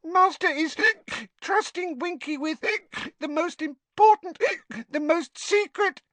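high, tipsy house elf; a wobbly, squeaky little elf. A narrator's reading. Winky boasting drunkenly.

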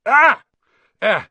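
sudden loud, high "Ah!"; yelp of pain. A narrator's reading. Hagrid bitten on the leg by Norbert, pained yelp.

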